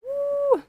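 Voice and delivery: high, drawn-out "woo" of excitement; excited whoop. Real, not acted. Co-caster thrilled to be back for the match, excited whoop. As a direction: let out a real high-pitched whoop.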